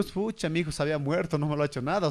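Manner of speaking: low, soft letdown sigh of a lightly voiced ghost; mock dismay, voice dropping low and soft; disappointed dead man. Dead father finds his son died, low and softly let down. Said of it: "y dijo".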